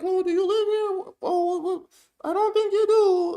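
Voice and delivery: high, mock-sweet voice of a suspicious neighbor; mocking woman's voice. Commentator mimicking the woman questioning the homeowner, mock high voice.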